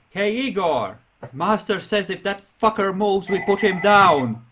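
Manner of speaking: loud, high-pitched yell to Igor. Acted. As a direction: shout the order across to someone. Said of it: "You hear her, uh, yelling".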